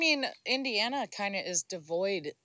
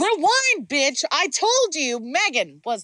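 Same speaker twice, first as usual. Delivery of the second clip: high, loud heated correction; raised, insistent comeback; loud bickering; 6 semitones higher, 5 dB louder, slower than usual. Real, not acted. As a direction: shout them down to set the record straight. Arguing over who invented selfie.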